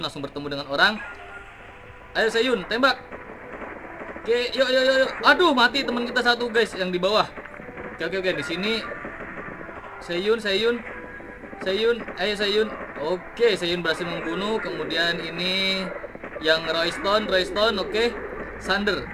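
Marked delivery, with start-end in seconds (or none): none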